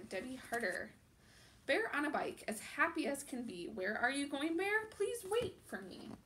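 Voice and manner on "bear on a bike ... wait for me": put-on louder, lively storytime reading to kids; animated children's book narration; picture book read-aloud. A narrator's reading. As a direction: read it out like storytime for little ones.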